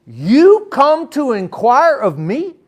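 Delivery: incredulous question. Shown as a demonstration. Pastor voicing God rebuking the elders, slow and incredulous. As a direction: drag it out as a stunned rebuke. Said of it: "he is saying to Ezekiel".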